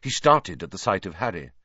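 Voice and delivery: usual speaking voice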